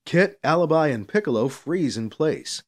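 plain reading voice

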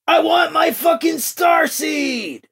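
high, drawn-out furious shout; strained, high-pitched angry yell; prisoner's scream. A narrator's reading. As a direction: scream the demand from a cell, slowly. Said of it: "the prisoner screamed".

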